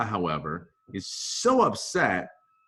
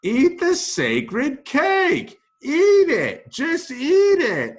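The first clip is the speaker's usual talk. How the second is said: loud, high, slow commanding yell; a hammed-up priest shouting orders; pushy shout; 9 dB louder, much slower than usual. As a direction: force the cake on them, bellowing. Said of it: "And he says".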